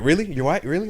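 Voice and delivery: excited. Retold or imitated. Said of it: "got excited".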